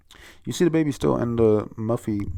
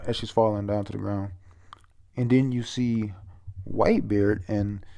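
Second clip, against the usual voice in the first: usual speaking voice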